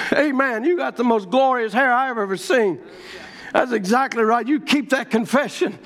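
ordinary talk

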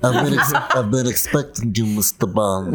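low, menacing spoof villain; Bond villain voice. Pretend. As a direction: purr it like a movie villain. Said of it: "see, that's better".

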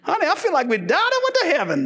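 high thrilled whoop breaking into a laugh; excited whoop. Retold or imitated. Preacher telling his wife about the steaks, high excited whoop. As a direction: whoop it up as if the steaks were heaven sent. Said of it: "I'm like".

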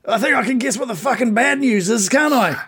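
high-pitched, indignant bugbear voice; sardonic captive bugbear. Acted. Captive bugbear answering his captor, sour and indignant. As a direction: grumble it high at your captor.

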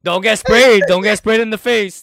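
teasing shout. Real, not acted. Teasing his friend about wrestling, loud laughing shout. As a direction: shout the tease through laughter.

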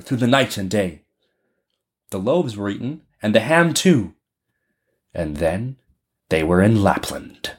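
ordinary delivery, nothing marked

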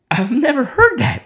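high, choked voice imitating someone in tears; tearful. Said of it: "in tears".

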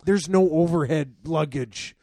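loud, high, hammed-up griping; mock irate passenger; complaining dad. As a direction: complain at full volume like an exasperated dad. Dad's complaints acted out.

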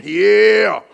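loud drawn-out party whoop; bravado facade. Acted. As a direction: put on a big front for the boys. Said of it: "I got this facade out here".

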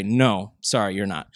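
a quiet mock-apologetic no; dismissive. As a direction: brush them off quietly. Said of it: "And then we say".

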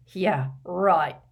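heavy scepticism; snorting sarcasm. A narrator's reading. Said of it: "snorted Hagrid".

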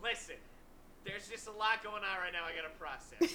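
distant, off-mic voice; talking away from the mic; from across the room. Real, not acted. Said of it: "A, a little closer to the mic".

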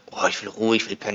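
tired moaning. Retold or imitated. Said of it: "Und davor war's so".

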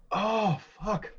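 crying. Real, not acted. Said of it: "You have tears in your eyes".

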